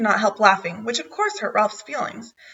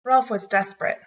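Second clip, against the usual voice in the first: ordinary delivery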